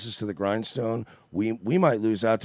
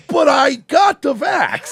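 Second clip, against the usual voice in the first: high, slow shouted protest; shouted whiny plea, pitched way up; desperate shout; 8 dB louder, much slower than usual. Retold or imitated. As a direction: cry out in disbelief at the accusation. Jimmy Dore protesting.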